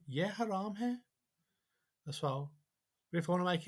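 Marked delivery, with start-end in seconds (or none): 0.1-1.0 "Ye haram hai" climbing high on hai; strong questioning lift at hai; question by intonation. Shown as a demonstration. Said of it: "Could be more of a intonation".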